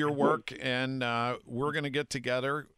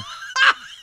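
big laugh; 9 dB louder than usual. Real, not acted. Laughing at impression.

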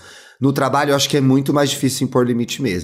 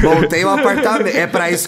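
laughing; 5 semitones higher, 3 dB louder than usual. Real, not acted. Laughing at the triplex joke.

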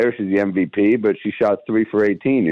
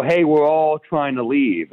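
retold polite complaint; 7 semitones higher, slower than usual. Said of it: "is what I said".

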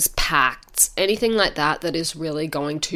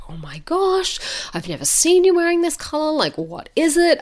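excited; 11 semitones higher than usual. Retold or imitated. Friend reacts to new blush.

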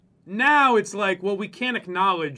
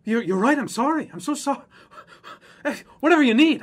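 high, loud, over-apologetic whine; mock groveling apology; 6 semitones higher, 5 dB louder than usual. Mocking people who cave to critics, grovelling.

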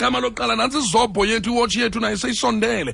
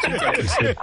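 laughing mimic; slower than usual.

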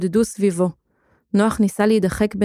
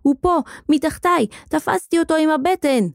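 put-on high, excited child's cry; boy's shout; 7 semitones higher than usual. A narrator's reading. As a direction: announce the catch, pitched high. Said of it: "צעק הילד". (צעקה נרגשת של ילד)

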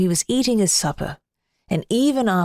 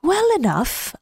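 moon voice; 7 semitones higher than usual. Acted. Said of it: "answered the moon".

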